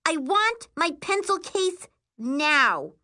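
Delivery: high, drawn-out angry demand; screaming demand. A narrator's reading. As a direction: slowly scream out each demanding word. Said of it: "Miri screams".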